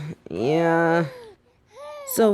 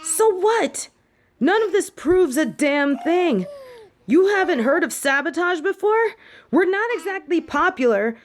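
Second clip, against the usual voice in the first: higher, heated pushback; defiant protest; 9 semitones higher than usual. Acted. Student defends himself to the headmistress, heated and defiant. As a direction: fire back at the accusation.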